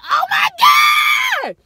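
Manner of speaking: very high, slow, loud squeal; excited shout. Retold or imitated. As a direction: scream it out in thrilled shock. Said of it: "And then I'm like".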